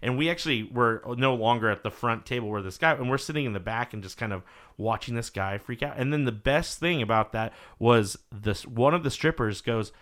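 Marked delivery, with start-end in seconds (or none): none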